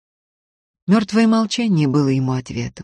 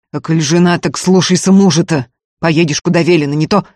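loud, forceful order; heated command turning to threat; bossy threat; 2 semitones higher, 7 dB louder than usual. A narrator's reading. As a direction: lay down the law in a quarrel. Ефим приказывает жене в ссоре.

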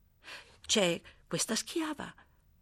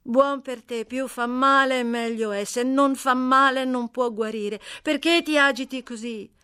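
put-on a louder, higher, brisk voice for the scrappy girl; 6 semitones higher, 7 dB louder than usual. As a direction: bold and cheeky, talking back fast. Amy lectures Seth on pain. (ragazza sfacciata, voce più alta e forte)

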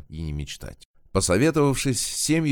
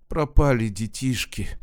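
higher-pitched tearful moan, lightly acted; 5 semitones higher than usual. A narrator's reading. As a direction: moan it out in distress. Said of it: "простонала она".

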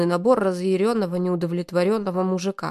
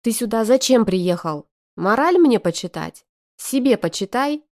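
irritated retort; 3 semitones higher, 5 dB louder than usual. Acted. Sister snaps at brother.

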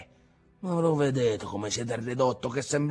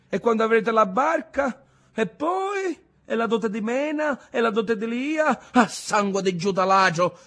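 high, loud, sarcastic listing; mocking tirade; 10 semitones higher, 5 dB louder than usual. A narrator's reading. Ntoni mocking his grandfather's hopes for house and dowries, high and loud. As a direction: ridicule each dream in turn. Ntoni mocks the family's dreams.

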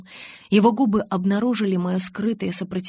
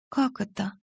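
choking; 3 dB quieter than usual. Acted. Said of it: "поперхнулась я".

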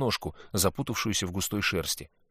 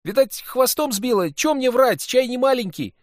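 put-on higher, louder excited boy; boy's voice; 9 dB louder than usual. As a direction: play a cheeky lying boy. Sid swears he isn't lying.

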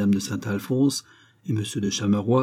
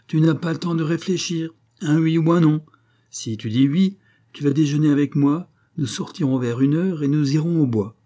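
a lightly voiced lady, pitched higher and pressing; brisk society woman, higher; 6 semitones higher, 4 dB louder than usual. A narrator's reading. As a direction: rush him into a yes, pitched up. Saint-Alphonse pushes the deal.

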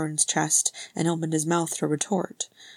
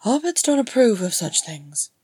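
louder, higher brusque retort; rough; 5 semitones higher, 6 dB louder than usual. Acted. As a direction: brush it off roughly. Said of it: "Bilbo said roughly".

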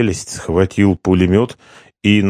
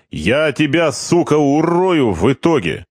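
put-on high, rough threat from a drunk corporal; drunk soldier threat; 8 semitones higher than usual. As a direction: menace him over the cards.

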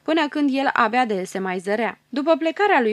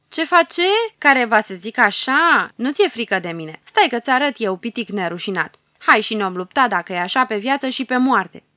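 the bearded ruler furious at the dwarf; 6 dB louder than usual. Acted. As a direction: roar back at the cheeky dwarf. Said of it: "se răstește furios".

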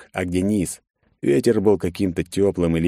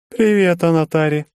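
high, loud bright hello; cheerful greeting; 11 semitones higher, 4 dB louder than usual. A narrator's reading. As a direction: chirp the greeting. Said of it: "невозмутимо поздоровалась".

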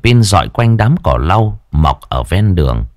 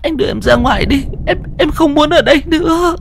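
much higher, fast, near-crying plea; tearful pleading wife. A narrator's reading. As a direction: beg to be taken outside, on the edge of tears. Terrified wife begs to leave. (giọng vợ van xin, mếu máo)

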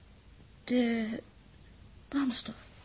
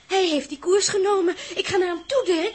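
loud, higher, upset; upset outburst; 5 semitones higher, 9 dB louder than usual. Acted. Els defending Joost against Dirk, loud upset outburst.

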